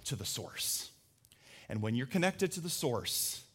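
low, soft, with long pauses; hushed, solemn. Real, not acted. Preacher telling the congregation some are dry, hushed. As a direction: let each phrase land gently.

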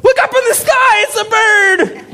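high, slow mock yells at a passer-by; hammed-up street shouting. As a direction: shout like a wide-eyed stranger. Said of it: "ran into that person on the street".

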